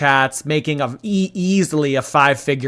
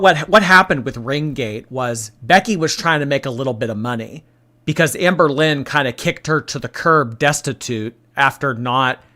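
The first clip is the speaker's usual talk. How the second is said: ordinary talk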